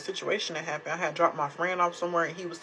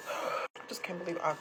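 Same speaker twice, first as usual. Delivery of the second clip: breathy whispered aside after a sigh; trailing off in disbelief, barely voiced; hushed under the breath; 9 dB quieter than usual. Real, not acted.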